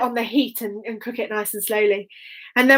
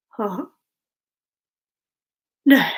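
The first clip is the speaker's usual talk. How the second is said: plain reading voice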